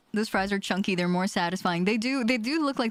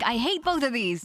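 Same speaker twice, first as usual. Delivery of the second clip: usual speaking voice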